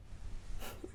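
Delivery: a tiny breathy giggle; soft whispered laugh. Acted. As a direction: laugh under your breath.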